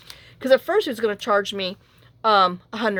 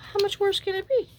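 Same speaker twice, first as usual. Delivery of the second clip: incredulous; 12 semitones higher, 3 dB quieter than usual. Retold or imitated. Incredulous inner thought.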